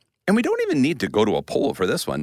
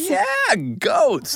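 goats said very high; excited emphasis; 4 dB louder than usual. Acted. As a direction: squeal the word goats gleefully. Emphatic answer.

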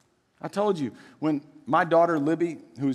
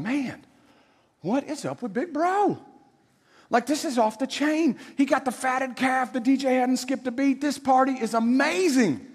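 higher, lightly voiced servant chatter; gossiping servant; 8 semitones higher than usual. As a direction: dish the gossip like a kitchen servant.

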